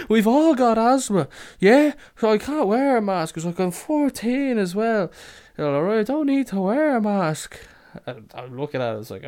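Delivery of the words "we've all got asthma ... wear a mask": loud, high-pitched mock teen outcry; hammed-up indignant kid excuses; mimicked kids protesting. Kids insist to the woman they need no masks, loud mock protest. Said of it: "they just go".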